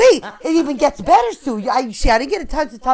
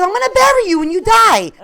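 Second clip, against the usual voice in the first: excited; 10 semitones higher, 7 dB louder than usual. Retold or imitated. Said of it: "She goes, she goes".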